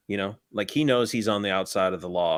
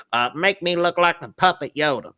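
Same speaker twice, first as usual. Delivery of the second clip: nerdy patient; 8 semitones higher, 5 dB louder than usual. Acted. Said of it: "patient role".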